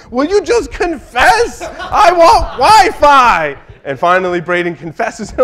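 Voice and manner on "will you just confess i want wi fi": put-on much higher, slow, laughing little-kid whine; whiny four-year-old. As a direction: beg like a small child desperate for Wi-Fi. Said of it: "my four-year-old is hitting Braden with his iPad going".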